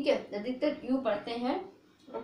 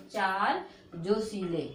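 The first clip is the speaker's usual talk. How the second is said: slow mnemonic; 3 semitones lower, slower than usual. Shown as a demonstration. Teacher gives students a memory trick, slowly. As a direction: say चार जोशीले slowly and evenly. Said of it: "ऐसे बोल जाओ".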